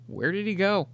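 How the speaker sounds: high-pitched, puzzled question; mock bewilderment. Imitating parents who can't find their dog, bewildered. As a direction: ask it as if totally baffled.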